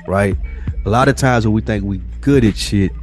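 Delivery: usual speaking voice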